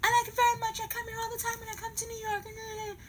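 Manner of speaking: very high-pitched mock voice. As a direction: imitate him in a high falsetto. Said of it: "And he said".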